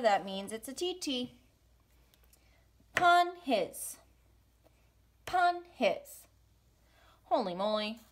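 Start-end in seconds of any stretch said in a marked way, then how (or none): 2.9-6.3 Pun his said high, as one beat; rhythm demo. Shown as a demonstration. Teacher modelling the rhythm for students. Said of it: "two sounds connected on one beat".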